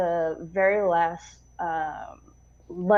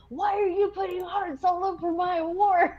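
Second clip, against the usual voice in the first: mock officer; 11 semitones higher than usual. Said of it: "officer quote continues".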